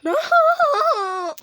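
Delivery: high loud cry; scream. Real, not acted. Gamer scared, crying out high. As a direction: cry out in fright.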